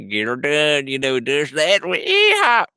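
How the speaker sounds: hint of a country comic, whooping at the end; Larry the Cable Guy. Voice actor quoting Pixar's comic-relief character, lightly mimicked Southern voice. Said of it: "Larry the Cable Guy, and he puts on that".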